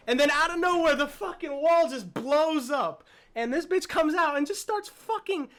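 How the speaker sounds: loud, high burst of hyped storytelling; excited recap. Real, not acted. Fan retelling the monster bursting through the wall, hyped up. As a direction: blurt out the shock moment, loud and high. Recounting the monster's sudden attack.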